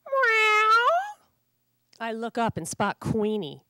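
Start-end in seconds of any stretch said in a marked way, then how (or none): 0.1-0.7 loud, high mewing meow; cat announcing herself on the fence. Acted. Neighborhood cat appears.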